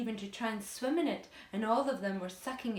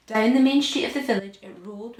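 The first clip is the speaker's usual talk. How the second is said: dramatic narration; 14 dB louder than usual. A narrator's reading.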